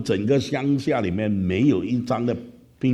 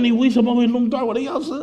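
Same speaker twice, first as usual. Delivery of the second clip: high, much higher scolding question; angry pastor; 3 dB louder than usual. Retold or imitated. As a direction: pastor demands answers, upset. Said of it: "就当得好生气啊". (牧师生气地质问)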